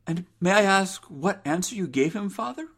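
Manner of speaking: high, polite daughter's voice; inquiring daughter. Acted. Daughter asks father for his answer, high and earnest. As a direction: ask politely, lifting at the end.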